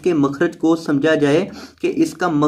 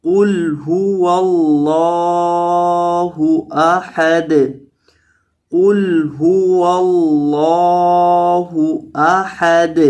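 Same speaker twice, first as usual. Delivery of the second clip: very slow, drawn-out melodic tilawat; Quran recitation; 3 semitones higher, much slower than usual. Shown as a demonstration. Teacher reciting the sura slowly for students. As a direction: stretch every syllable in a chanted recitation. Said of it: "تلاوت کے انداز میں پڑھنا".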